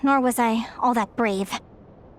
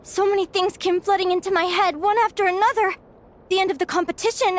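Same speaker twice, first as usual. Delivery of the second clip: higher, shaken telling of a vision; shaken and frightened; 8 semitones higher than usual. Acted. To her friend after waking, shaken and frightened. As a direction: let the fear of it rise through each line.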